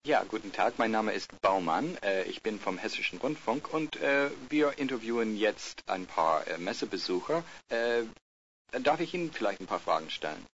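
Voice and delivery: usual speaking voice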